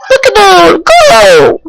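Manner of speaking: loud high battle cry; shouting. Acted. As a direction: yell it out, pitch climbing. Throwing a Pokéball, battle cry.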